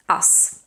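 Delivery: "Us" breathed out with a long voiceless S; whispered voiceless us. Shown as a demonstration. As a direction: hiss the S of "Us" unvoiced. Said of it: "ends in a voiceless S".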